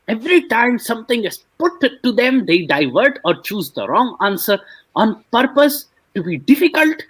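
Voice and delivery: high, whiny voice reading the comment; mocking comment voice. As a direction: sneer the critic's words back.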